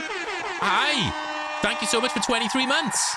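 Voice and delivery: high, bright burst of real gratitude; excited thanks. Real, not acted. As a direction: squeal the hi, then gush the thanks up high. Thanking viewer for subscription.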